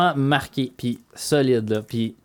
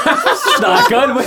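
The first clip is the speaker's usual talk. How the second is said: high, loud laugh through words; 12 semitones higher, 8 dB louder than usual. Real, not acted. Laughing while answering.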